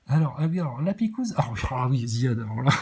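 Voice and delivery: ordinary talk, nothing marked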